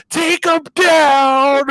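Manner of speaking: high, slow, drawn-out cheer; egging on a fighter. Retold or imitated. Wife urging the fighter on from her hospital bed, high drawn-out cheer. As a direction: stretch it out like a fan at a fight. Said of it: "She is like".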